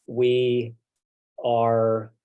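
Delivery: ordinary talk, nothing marked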